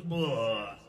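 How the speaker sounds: loud, low frog "bleh"; frog noise, corrected take. Acted. Comic corrects what the frog goes, loud low croak. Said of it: "It doesn't do that. It goes".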